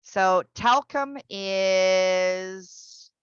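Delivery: talcum is stretched very slowly; very slow drawn out. Shown as a demonstration. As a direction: drag out So talcum is. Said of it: "teacher drags out talcum".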